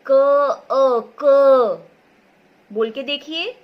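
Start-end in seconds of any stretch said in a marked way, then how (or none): 0.7-1.9 loud separated sound demo. Shown as a demonstration. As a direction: say अ then क much louder, each one separate. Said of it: "क के बाद अ की ध्वनि आई".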